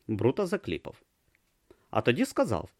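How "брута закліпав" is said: ordinary delivery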